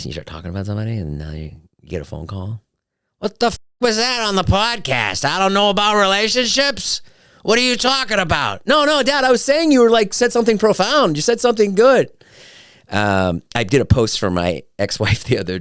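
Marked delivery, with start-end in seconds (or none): 3.2-8.7 high-pitched mock outrage; hammed-up, high indignant caller; imitating irate dad. Imagined angry phone call from dad. 8.7-12.1 high, frantic backpedaling; hammed-up panicked self-defense; mock pleading. Placating dad in imagined call.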